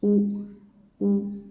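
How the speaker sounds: "U" with lips pushed forward; rounded u. Shown as a demonstration. Teacher showing u lip position. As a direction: open at front of lips. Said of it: "front of the lips".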